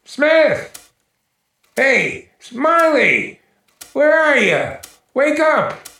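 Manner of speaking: loud, high calls through the house; calling out. Acted. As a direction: call out to missing friends. Searching the house, calling friends.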